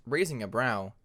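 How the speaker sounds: plain reading voice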